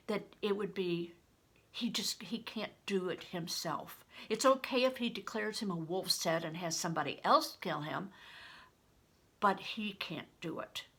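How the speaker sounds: usual speaking voice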